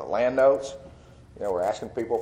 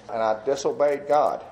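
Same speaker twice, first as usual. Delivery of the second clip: ordinary talk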